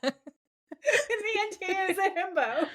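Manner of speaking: high, laughing delivery. Real, not acted. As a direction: deliver the verdict while cracking up. Teller cracking up over the joke.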